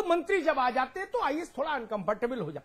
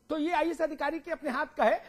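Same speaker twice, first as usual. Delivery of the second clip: ordinary talk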